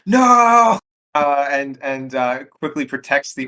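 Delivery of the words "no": loud, high, drawn-out yell; full-voiced yell of refusal; yelled protest. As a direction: cry out in alarm as the character.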